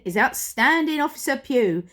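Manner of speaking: plain reading voice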